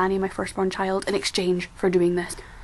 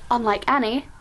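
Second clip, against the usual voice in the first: normal talking voice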